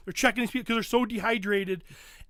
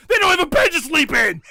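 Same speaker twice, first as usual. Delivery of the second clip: louder and higher, an outraged shout; exasperated shout; 7 semitones higher, 11 dB louder than usual. Real, not acted. Ranting to friends about the women's camp, in an exasperated shout. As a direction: throw up your hands and yell it.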